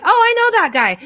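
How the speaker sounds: high, bright oh of recognition; suddenly recognize him; recognition surprise. Retold or imitated. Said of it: "was like".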